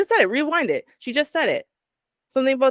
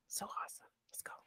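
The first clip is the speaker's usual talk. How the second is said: breathless excited whisper; 27 dB quieter, faster than usual. Real, not acted. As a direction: drop to a hushed whisper. Hyping up the next clip.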